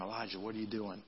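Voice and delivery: hushed and low, barely above a breath; soft God whisper. Acted. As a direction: whisper it like God's still small voice. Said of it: "began to whisper him".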